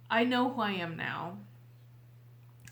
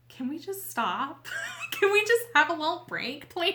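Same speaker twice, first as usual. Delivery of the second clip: pleading for a break, higher, louder, laughing through it; exasperated plea; 8 semitones higher, 7 dB louder than usual. Retold or imitated. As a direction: beg the world to stop, half joking. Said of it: "you're just like".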